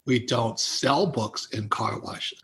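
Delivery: slow, low, deliberate refusal; retold firm refusal. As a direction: imitate a friend turning him down, word by word. Friend refusing his sales request.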